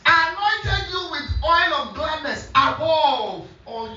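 very slow, high preaching voice; emphatic scripture echo. Real, not acted. Preacher repeats the anointing verse to congregation, slow emphatic proclamation. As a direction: proclaim it phrase by drawn-out phrase.

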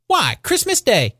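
put-on high-pitched boy's exclamation; piping, incredulous lad; wondering boy. A narrator's reading. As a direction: answer like an amazed child. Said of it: "with all his might of wonder".

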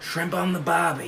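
lightly put-on Australian voice; mock Australian, not shouted; attempted Australian accent. Shown as a demonstration. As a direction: try a restrained Australian accent. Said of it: "can we do an Australian accent".

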